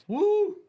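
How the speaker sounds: loud high whoop; joyful on-air whoop. Real, not acted. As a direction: let out a big cheer. Host cheering on air.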